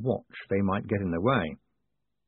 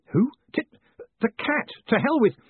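exasperated outburst; 13 semitones higher, 3 dB louder than usual. Acted. Moist explodes before stopping himself.

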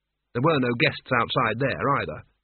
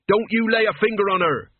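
high, loud furious yell; screaming; 7 semitones higher, 4 dB louder than usual. A narrator's reading. Mort to the Duke, furious scream. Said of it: "he screamed".

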